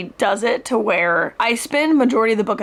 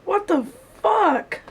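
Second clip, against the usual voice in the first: shocked; 9 semitones higher, slower than usual. Real, not acted. Shocked reaction to plot twist.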